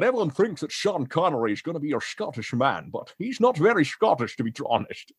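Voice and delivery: slurred-S Scottish film-star voice; Scottish burr with shushed S sounds; Sean Connery. Acted. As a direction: do a swaggering Scottish star, every S a SH. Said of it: "Sean Connery doesn't sound Scottish".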